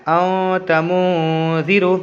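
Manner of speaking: "औ तमूजीरू" slowly, n sounds held as nasal hums; ikhfa nasal hold. Shown as a demonstration. Teacher shows how to read with ikhfa, nasal hold. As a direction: stretch "औ" and "मू" through the nose. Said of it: "नाक में आवाज़ को रोकना".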